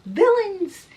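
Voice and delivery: high, loud accusing yell; yelled outburst. Acted. Murderer finally cracking before the officers, yelling out. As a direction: burst out with it at the top of the voice. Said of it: "he just yells out".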